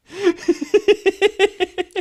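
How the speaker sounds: loud high-pitched laugh; burst of laughter. Real, not acted. Laughs at own My Pillow joke.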